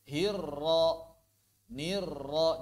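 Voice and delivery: "Hirra" with a long held rolled r; unhurried chanted "Hirra", leaning on the r; held tasydid. Shown as a demonstration. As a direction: hold the doubled r of "nirra" before releasing. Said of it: "Tahan dulu".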